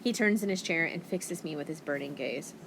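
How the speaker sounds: plain reading voice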